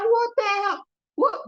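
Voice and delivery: high, loud-edged dismay; exasperated disbelief. Retold or imitated. Reacting to a snub from a peer, high exasperation.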